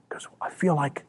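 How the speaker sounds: breathy hushed whisper. Retold or imitated. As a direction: whisper it as if utterly alone. Jesus voiced feeling alone.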